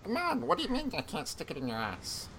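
raspy alien, crude and fast; sleazy cartoon alien growl; Scumbag Stitch voice. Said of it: "Scumbag Stitch".

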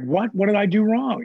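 retold stunned what, pitched high; shocked protest. To the boss who removed him, high shocked protest. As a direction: voice jumps up in disbelief. Protesting being fired as manager.

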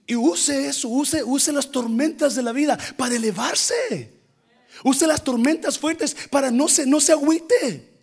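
fired-up preacher's call; preaching at full force. Acted. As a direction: urge the church on. Preaching exhortation.

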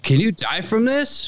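high, slow clueless question; mock clueless DJ. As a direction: play the dumb radio host. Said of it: "They were like".

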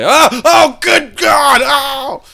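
high slow disgusted shout. Retold or imitated. Reliving washing in disgust.

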